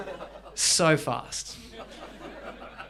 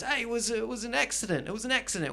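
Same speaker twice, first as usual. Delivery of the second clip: mildly acted higher-pitched protest; mock innocent plea, pitched up; protesting prisoner; 6 semitones higher, 4 dB quieter, faster than usual. Prisoner excusing a hard tackle to the referee, mock protesting. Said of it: "then the Rimutaka goes".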